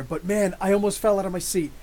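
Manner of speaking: ordinary delivery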